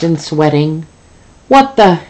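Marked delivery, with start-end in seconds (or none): 1.5-2.0 high, loud outraged demand; demanding shout. Acted. Angry boss answering the phone, shouting high. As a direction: bark it out, furious. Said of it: "he demands".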